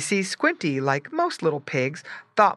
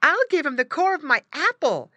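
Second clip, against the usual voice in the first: put-on high, louder little girl voice; eager sister; 12 semitones higher, 5 dB louder than usual. A narrator's reading. Sister feeding the caged pig, eager. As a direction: offer it brightly as a child.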